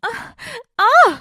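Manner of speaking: panicked cry. Acted. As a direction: cry out in short bursts. Aarohi cries out while attacked.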